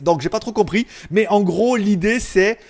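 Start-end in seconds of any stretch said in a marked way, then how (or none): none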